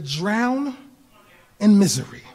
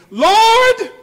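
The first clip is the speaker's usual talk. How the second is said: full desperate cry; 14 semitones higher, 10 dB louder than usual. Retold or imitated. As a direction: Lord cried out much higher and louder. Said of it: "there he go again".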